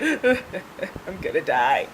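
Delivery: slow, quiet mock whimper; mock freaking out. Driver on ambulance steps, quiet mock-panicked whimper. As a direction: whimper it weakly, playing up the dread. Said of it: "I was still freaking out".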